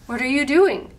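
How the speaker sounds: loud indignant scolding. Acted. Fern scolding the wolf over his bad behavior. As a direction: tell him off loudly.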